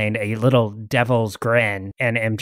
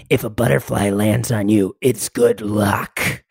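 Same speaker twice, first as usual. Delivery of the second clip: low mock-wise taunt; 5 semitones lower than usual. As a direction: purr the line at the dying pirate. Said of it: "and I go".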